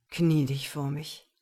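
low, loud command; demanding. A narrator's reading. Man demands woman obey him, low and commanding. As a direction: demand instant obedience. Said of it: "verlangte er".

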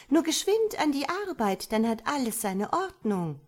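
high, loud urging call; ringing call. A narrator's reading. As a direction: hurry everyone to work, sun's voice. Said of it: "rief mit schallender Stimme". (hoch und laut antreibender Ruf)

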